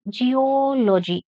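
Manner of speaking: "Geology" with a long, held "ol"; stress on OL. Shown as a demonstration. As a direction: say "Geology" slowly, stretching the OL. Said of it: "Stress on O L".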